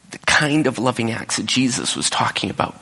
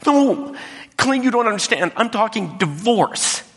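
pitched up high and urgent, slowing at the end; upset pleading; 13 semitones higher than usual. Retold or imitated. Wife pressing Clint to grasp the divorce, high and urgent. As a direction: insist desperately that he hear you.

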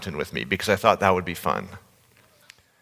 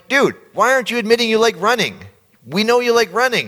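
much higher and louder, frustrated; exasperated outburst; 12 semitones higher, 4 dB louder than usual. Retold or imitated. Retelling pleading with his runner friend, exasperated higher and louder. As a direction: blurt it out at a stubborn friend. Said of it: "And now I'm getting mad".

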